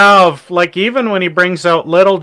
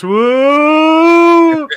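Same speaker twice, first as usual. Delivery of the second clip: long rising crowd whoop; mock crowd "wooo", swelling upward; imitated audience "woo"; 10 semitones higher, 4 dB louder than usual. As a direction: draw out a high woo like an audience reacting. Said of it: "and the whole audience goes".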